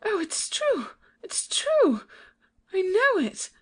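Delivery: high, breaking cry of realization; crying out in dismay. Acted. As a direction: she suddenly grasps the danger. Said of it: "with a cry springs from him".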